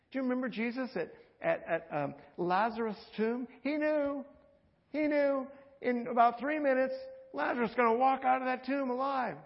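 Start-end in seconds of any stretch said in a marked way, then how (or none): 3.6-9.4 high, louder, urgent retelling; building excitement. Acted. Preacher to congregation, excited about Lazarus. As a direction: lift the voice as if telling good news.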